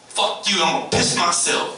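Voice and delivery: high, loud brat voice; mock child's inner defiance; defiant kid. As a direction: spit it at the parent like a brat. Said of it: "you can see it in their eyes".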